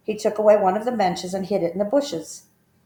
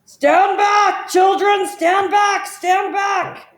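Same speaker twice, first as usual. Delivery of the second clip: much higher and louder, a booming warning shout; elephant shouting; 13 semitones higher, 9 dB louder, much slower than usual. A narrator's reading. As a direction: shout to stop the children in their tracks. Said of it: "deep voice from the jungle shouted".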